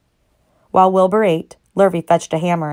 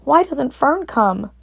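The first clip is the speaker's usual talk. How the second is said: tearful plea; 8 semitones higher, much slower than usual. A narrator's reading. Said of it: "He began to cry".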